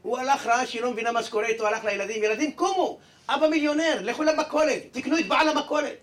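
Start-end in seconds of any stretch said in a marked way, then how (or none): 2.2-6.0 high, loud, thrilled announcing; jubilant shout. Acted. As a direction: wake the kids with wild excitement. Father wakes kids, now millionaire. (אבא צועק בהתלהבות לילדים)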